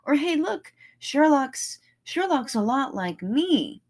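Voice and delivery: high, loud, thrilled recognition; light up like a kid spotting their hero; a fan shouting happily that a character is like them; delighted fan. Retold or imitated. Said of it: "their faces light up when they're like".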